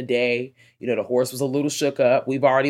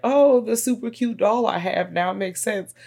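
mock excited realization; 8 semitones higher, slower than usual. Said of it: "I immediately was like".

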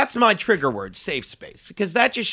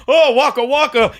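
louder, higher mock hack-comic bit; hammed-up comic catchphrase; 4 semitones higher, 5 dB louder than usual. Comic mocking lazy stage material, hammed-up hacky delivery. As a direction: lightly send up a corny stage comedian. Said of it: "I won't be like".

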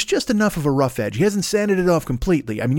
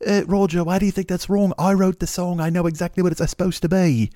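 higher, fussy mock English accent; 4 semitones higher than usual. Guitarist objecting to the singer, higher mock British voice. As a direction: protest politely in a put-on British voice. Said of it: "[name]'s like".

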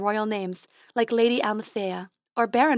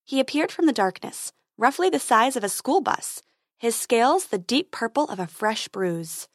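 ordinary delivery, nothing marked

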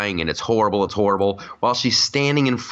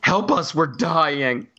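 high, whiny mock plea for help; 13 semitones higher, slower than usual. Mocking the mayor's T-shirt plea, whiny and high. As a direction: ham up a desperate cry for help.

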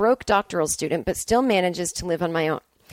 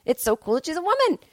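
mock excited; 6 semitones higher than usual. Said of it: "we were like".

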